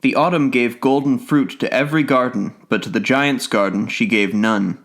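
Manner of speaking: plain reading voice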